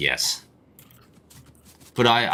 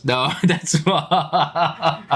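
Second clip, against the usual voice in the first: high, drawn-out laughing protest; real laughter swallowing the words; 9 semitones higher, much slower than usual. Real, not acted. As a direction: break up laughing mid-sentence.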